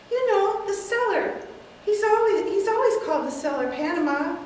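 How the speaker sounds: bright matter-of-fact lady; elderly aunt. Acted. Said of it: "take several characters".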